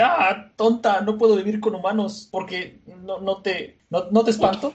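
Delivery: high-pitched, stammering flustered protest; flustered retort. Retold or imitated. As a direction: stumble over a flustered refusal. Voices flustered Chopper rebuffing Nami.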